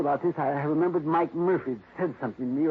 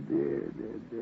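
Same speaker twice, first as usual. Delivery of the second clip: sighing whisper; 6 semitones lower, 6 dB quieter than usual. Real, not acted. Distressed about the window.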